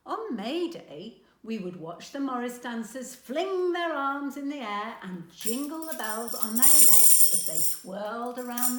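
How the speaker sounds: a granny lit up by memories; fond excitement. A narrator's reading. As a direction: relive the fun of May Day. Granny recalls Morris dancers.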